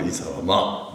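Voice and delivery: quiet dignified chiding; genteel reproof. Retold or imitated. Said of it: "좀 책망하신 거예요". (낮고 점잖게 책망하는 말투)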